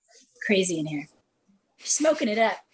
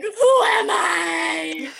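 drawn-out, higher mock soul-searching shout; mock searching cry; 7 semitones higher, 4 dB louder, much slower than usual. Abroad on a missions trip, mock wondering who am I. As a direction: stretch it out like a dramatic epiphany. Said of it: "and you're like".

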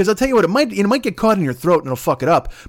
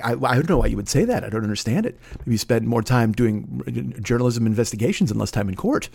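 lower, soft defensive mumble; Nathan Thurm impression; 9 semitones lower, 4 dB quieter than usual. Lawyer on the defensive with the interviewer, low and soft. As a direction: deflect the question nervously, quietly. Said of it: "That's fucking Nathan Thurm".

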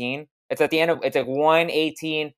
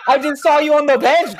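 high-pitched incredulous outburst; mock disbelief; 12 semitones higher, 4 dB louder than usual. Viewer to player seen on the bench, mock disbelief. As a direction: blurt it high, as if baffled.

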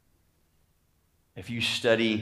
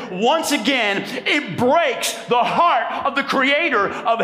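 higher and louder, driving the appeal home; full-force preaching; 12 semitones higher, 6 dB louder than usual. Real, not acted. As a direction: preach it hard to the whole room.

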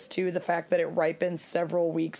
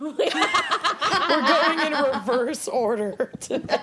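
laughing; 8 semitones higher, 4 dB louder, much slower than usual. Real, not acted. Joking about the drunk friend.

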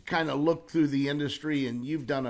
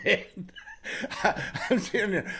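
higher, with real laughter breaking in; barely getting words out past the laughs; laughing; 6 semitones higher than usual. Real, not acted. Telling the host the punchline, laughing.

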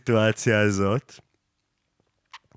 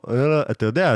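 normal talking voice

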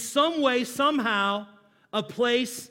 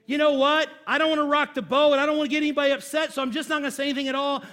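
higher, louder, fast anxious avoider; mock peacekeeper; 4 semitones higher, 4 dB louder, much faster than usual. Pastor to congregation, mocking timid peacekeepers, high and fast. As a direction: rattle it off like a nervous people-pleaser. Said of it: "Peacekeepers say".

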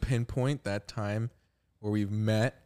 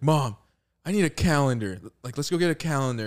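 put-on louder, higher kid's pestering of a parent; lightly acted, insistent request; retold plea to mom; 5 semitones higher, 6 dB louder than usual. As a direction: call out to mom with mild urgency. Retelling an urgent plea to mom.

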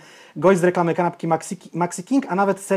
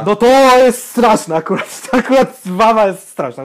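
laughing disgust; 5 semitones higher, 8 dB louder than usual. Real, not acted. Reacting to the nickname wawa.

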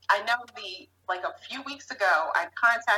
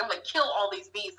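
usual speaking voice

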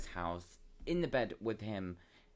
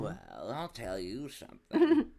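mock storyteller; 2 semitones higher, 7 dB louder, much slower than usual. Storytelling opener.

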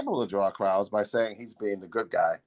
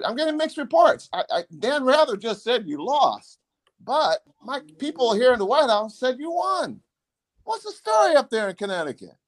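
President; 11 semitones higher than usual. Retold or imitated. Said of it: "He said".

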